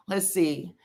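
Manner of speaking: ordinary talk, nothing marked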